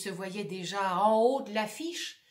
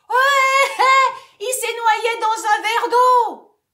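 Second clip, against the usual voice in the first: jeering crowd; 13 semitones higher, 15 dB louder, slower than usual. Acted. Public jeers at the actor.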